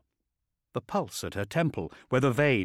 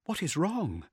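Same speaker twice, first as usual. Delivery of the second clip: high, worried woman's voice; anxious character voice, pitched up; concerned, rising panic; 8 semitones higher than usual. A narrator's reading. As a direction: ask it high with open concern. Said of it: "no concealing her concern or her rising panic".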